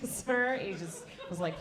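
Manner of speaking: usual speaking voice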